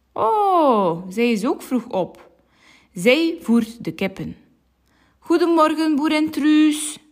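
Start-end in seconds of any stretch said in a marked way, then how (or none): 5.2-7.0 high, bright call of good morning across the yard. Acted. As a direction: call her name from a distance. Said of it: "roept agent Jan".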